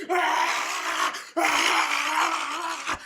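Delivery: loud roaring cry in a strange voice; imitating tiger. The tiger howling when its throat is twisted, loud mock roar. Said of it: "the tiger makes so much noise".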